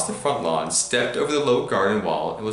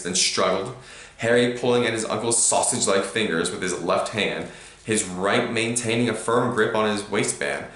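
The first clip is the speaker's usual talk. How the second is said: ordinary delivery, nothing marked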